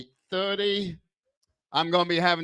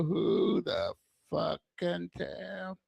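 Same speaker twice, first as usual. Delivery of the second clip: softer, slow, slurred repeat; quiet slurred echo; 2 semitones higher, 8 dB quieter, much slower than usual. Shown as a demonstration. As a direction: mumble it again slowly, softer. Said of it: "Is he slurring his words?".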